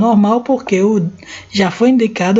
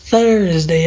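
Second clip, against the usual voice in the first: normal talking voice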